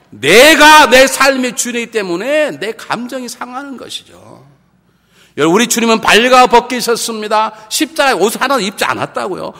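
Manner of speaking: loud, high, impassioned sermon; full-force preaching. Real, not acted. Preacher contrasts Jesus on the cross with us, full-force preaching. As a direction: drive it home to the whole congregation.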